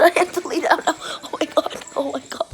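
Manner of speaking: ordinary delivery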